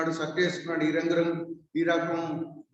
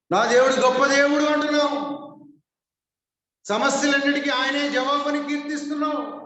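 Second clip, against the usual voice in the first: high, ringing pulpit voice pressing each claim; fervent preaching; 8 semitones higher than usual. Acted. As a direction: preach it out to the whole hall. Preacher exhorting at full force.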